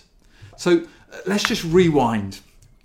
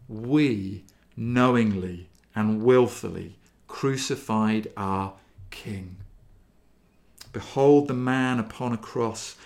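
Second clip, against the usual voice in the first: normal talking voice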